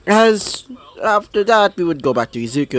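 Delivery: plain reading voice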